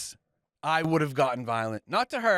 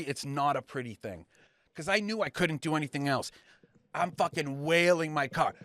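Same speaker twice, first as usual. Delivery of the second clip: ordinary delivery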